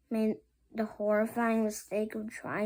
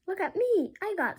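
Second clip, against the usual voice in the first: much higher and louder boast; silly superhero voice; 10 semitones higher, 4 dB louder than usual. Acted. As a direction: show off like a goofy hero. Hero shows off superpowers.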